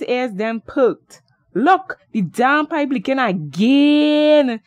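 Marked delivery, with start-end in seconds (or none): 1.5-4.6 higher and louder, slow and emphatic; excited exclaim. Acted. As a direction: point it out loud, dragged out in alarm. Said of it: "he exclaimed".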